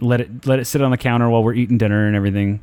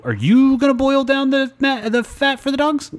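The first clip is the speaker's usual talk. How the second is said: much higher, incredulous; mock incredulous question; 14 semitones higher than usual. Pointed high question to his mother-in-law about boiling fat. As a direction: play it as disbelief, voice way up.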